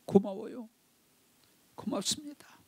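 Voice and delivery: soft, breaking whispered thanks; sincere thanks. Retold or imitated. Son kneeling, thanking missionary sincerely. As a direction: thank him from the heart, near tears. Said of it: "진심으로 무릎을 꿇고".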